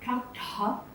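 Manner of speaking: "Ta, ta" with the tongue curled up to the roof of the mouth; "Ta, ta", tongue tip pointing up to the palate; retroflex ta. Shown as a demonstration. Teacher modelling retroflex "Ta, ta" for the class. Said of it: "lift the tongue up and point it to the roof".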